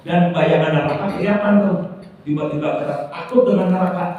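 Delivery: high, loud, driving sermon delivery; urgent preaching. Real, not acted. Preacher warning listeners of sins and hellfire, urgent.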